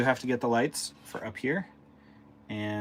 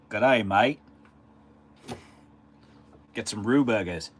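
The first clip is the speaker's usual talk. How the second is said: put-on Australian accent; 7 dB louder than usual. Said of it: "Somewhere in the outback?".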